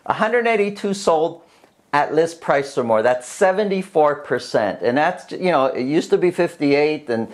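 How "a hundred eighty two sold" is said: suppressing laughter. Real, not acted. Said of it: "I'm trying not to laugh".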